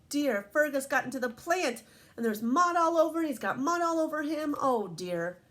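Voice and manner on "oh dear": plain reading voice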